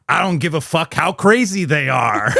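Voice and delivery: Clooney line. Retold or imitated. Fan quoting his favorite George Clooney line in a mock-tough voice. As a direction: snap it back like a hardened criminal. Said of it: "he goes".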